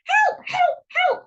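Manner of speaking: very high, loud cries; yelling for help. A narrator's reading. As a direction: squeal for help in storybook panic. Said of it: "yells Mister Bumble".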